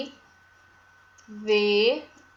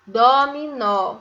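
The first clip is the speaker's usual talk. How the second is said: "Dominó" as a loud, slow "dó-mi-nó"; syllable by syllable; 6 dB louder, slower than usual. Shown as a demonstration. Teacher naming a game for pupils, "Dominó" syllable by syllable. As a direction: sound out all beats of "Dominó". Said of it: "Vamos ver a escrita".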